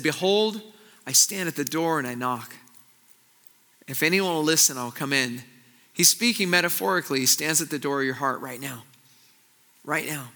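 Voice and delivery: soft, low, reverent reading of the verse; preacher softly reading Jesus's words to the congregation; hushed scripture reading. Acted. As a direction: lean in and let the promise land gently.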